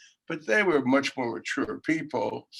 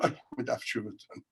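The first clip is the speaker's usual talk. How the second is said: muttered to himself; 3 semitones lower, 8 dB quieter than usual. Retold or imitated. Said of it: "I say to myself".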